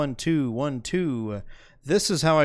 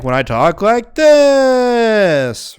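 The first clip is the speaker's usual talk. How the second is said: raised and drawn out, a notch below full volume; slow raised voice; moderately loud demo; 8 semitones higher, 8 dB louder, much slower than usual. Shown as a demonstration. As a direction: talk up loud but steady, not yelling. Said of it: "but not, like".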